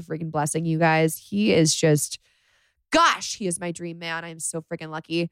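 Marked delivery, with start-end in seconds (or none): none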